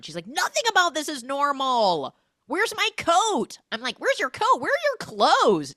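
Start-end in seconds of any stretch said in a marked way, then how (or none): 0.3-3.6 high, loud outrage; mock panicked yell. Retold morning-after panic. 4.0-5.7 very high, loud demand; mock indignant yell. Host mockingly echoes the panicked question.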